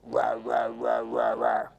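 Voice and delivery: deep growling rah rah; low dinosaur growl. Acted. Therapist voicing dinosaur growling at child, low growl.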